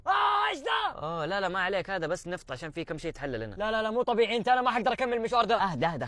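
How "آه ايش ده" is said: startled high yelp; sudden disgusted shout; shocked yell. Acted. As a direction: recoil in disgust, loud and high. Shocked at the oil in the car.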